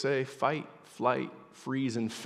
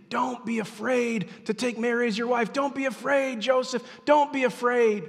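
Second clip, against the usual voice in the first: high, insistent angelic reassurance; preacher voicing an angel's urging; urgent proclamation; 11 semitones higher, 5 dB louder than usual. Angel's command voiced in scripture.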